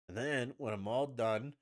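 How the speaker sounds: slow, singsong mock cooking host; mocking, drawn-out foreign-style "spaghetti"; imitating a celebrity TV cook. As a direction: stretch the recipe out, relishing "spaghetti". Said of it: "however the fuck she says it".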